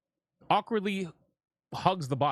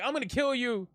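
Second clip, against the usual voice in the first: mocking threat; 8 semitones higher than usual. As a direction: sneer at her empty threats, voice pitched up. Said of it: "she's like".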